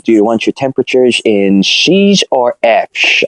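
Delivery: put-on slow, careful Dutch-accented question; a Dutch porter slowly offering the options. Dutch porter asks about Celsius or Fahrenheit, slow Dutch accent. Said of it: "It's my Dutch accent".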